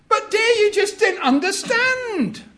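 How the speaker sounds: high, loud whiny excuse, half laughing; whining husband. Acted. Husband excusing the burnt cake to wife, high and whiny. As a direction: plead like a put-upon husband.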